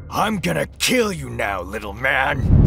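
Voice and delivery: threatening villain, slowing into the last words; menacing villain roar, held in check; Scorpion roaring. A narrator's reading. Rage-filled Scorpion to Miles after ripping free, roaring. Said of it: "ripped himself free and roared".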